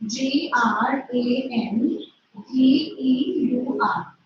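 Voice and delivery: spells G R A N then D E U R slowly, letter by letter; slow spelling. Shown as a demonstration. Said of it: "Okay, grandeur".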